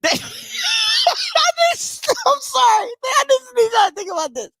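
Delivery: high squealing laugh-talk; wheezing with laughter, can barely speak; helpless laughter. Real, not acted. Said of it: "I'm sorry. I don't know why this is so funny".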